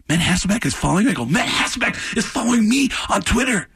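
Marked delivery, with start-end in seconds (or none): 1.2-3.7 high-pitched giddy fan outburst; freaked out. Retold or imitated. Fan tells his friend of Twitter follow, freaked out. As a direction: exclaim it, hammed-up and overexcited. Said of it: "I freaked out".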